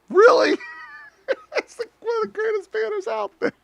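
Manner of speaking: very high, squeaky fake protest; mock hurt. Mock hurt at banner teasing.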